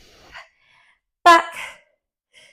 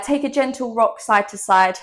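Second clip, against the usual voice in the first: ordinary delivery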